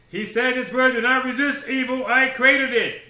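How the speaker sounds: loud, high, ringing pulpit declaration; preaching at full force. Real, not acted. Preacher hammering the point to the congregation, full voice. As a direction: drive it home to the congregation.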